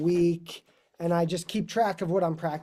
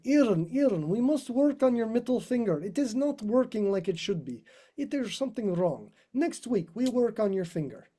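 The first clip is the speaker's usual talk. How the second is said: higher, put-on Russian accent for the teacher's scolding; 6 semitones higher than usual. Russian teacher Dima fretting over a student's finger, in a Russian accent. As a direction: imitate a fussy Russian violin teacher in a Russian accent. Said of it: "grab my hand and say".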